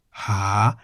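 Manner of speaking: low-starting "ha" that climbs; rising tone on "ha". Shown as a demonstration. Teacher names the syllable the mark is added to, rising tone. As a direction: slide "ha" upward. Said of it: "in the rising tone".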